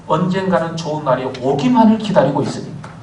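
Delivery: usual speaking voice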